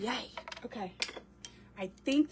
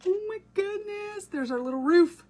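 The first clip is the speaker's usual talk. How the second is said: delighted gasp; 10 semitones higher, 6 dB louder than usual. Real, not acted. Crafter sees the little roof come together, delighted surprise. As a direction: marvel at the finished piece.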